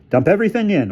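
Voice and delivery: high, loud command; brisk order. Acted. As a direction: call out the order. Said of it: "ordered Bruno".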